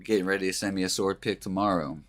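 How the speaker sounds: ordinary talk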